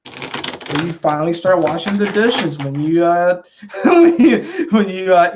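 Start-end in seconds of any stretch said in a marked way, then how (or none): 3.6-5.3 laughing through the words. Real, not acted. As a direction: giggle while saying it. Laughs at the dishes joke.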